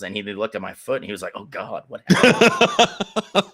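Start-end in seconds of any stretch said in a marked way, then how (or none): 1.4-3.4 loud, high, drawn-out shock; stretched-out comic alarm; mock horrified. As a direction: imitate a coach appalled by an injury. Coach reacts to swollen foot.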